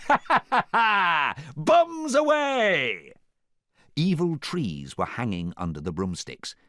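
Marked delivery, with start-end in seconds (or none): 0.1-1.3 loud, high villain cackle; theatrical evil ha-ha-ha; gloating staged cackle; evil laugh. A narrator's reading. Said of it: "laughed an evil laugh". 1.5-3.1 high, loud, long-drawn shout; theatrical villain yell; gleeful war cry. A narrator's reading. Villain ordering the bombing.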